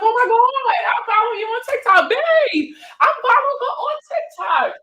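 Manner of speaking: put-on drunk excited fan. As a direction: shriek it like a tipsy superfan. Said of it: "of course, she was drunk".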